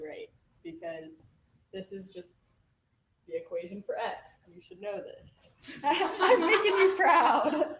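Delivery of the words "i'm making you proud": loud, high, drawn-out laughing boast. Real, not acted. Student teaching in tutor's place, gleeful boast. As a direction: crow it loudly through laughter.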